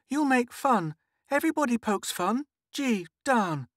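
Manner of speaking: put-on high little-girl complaint; protesting child. A narrator's reading. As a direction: object to mum in a high, sulky voice.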